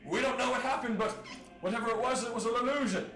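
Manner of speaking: loud, high public announcement; guard rallying a crowd at full volume; town crier shout. Acted. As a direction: holler it out to the whole street. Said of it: "blowing the horn".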